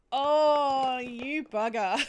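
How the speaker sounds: loud drawn-out oh, falling into laughter; amused mock outrage. Real, not acted. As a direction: groan at a friend's cheeky prank. Discovers the cheeky joke gift.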